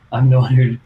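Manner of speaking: ordinary talk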